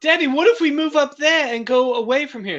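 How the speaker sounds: put-on high, pleading little kid voice; a small kid begging dad; child voice. Kid asking dad to move.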